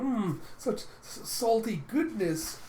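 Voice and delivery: high, slow relish; savouring. Retold or imitated. Glutton digging full on into the meal, savouring. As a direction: draw it out with greedy delight.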